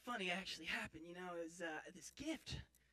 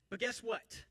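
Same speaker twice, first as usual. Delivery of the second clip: "But guess what?" raised and louder, as a teaser; dramatic suspense; 4 semitones higher, 5 dB louder, slower than usual. Acted. Storyteller setting up his story, "But guess what?" in dramatic suspense. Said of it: "I'm using it for dramatic suspense".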